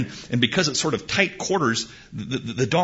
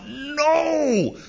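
furious no; 4 dB louder than usual. Acted. Balak furious outburst.

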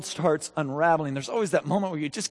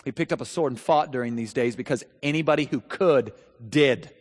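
normal talking voice

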